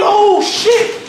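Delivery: high, hyped-up retold shriek; squealed astonishment, stretched out; energy suddenly changed. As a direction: explode upward in amazed excitement. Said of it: "my energy done changed".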